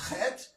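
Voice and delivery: "chet" with a throaty kh at the start; guttural. Shown as a demonstration. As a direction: scrape the first sound of "chet" in the throat. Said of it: "It's guttural".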